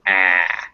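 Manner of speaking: "ah" as the vowel in hat, jaw dropped; open front a. Shown as a demonstration. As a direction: open the mouth into "ah" with the tongue low. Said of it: "let your jaw drop down".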